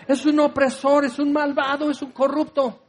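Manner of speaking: hammed-up pious woman wishing him a fall; mock venom rising in pitch toward the insults; spiteful curse. Bystander cursing Zaqueo.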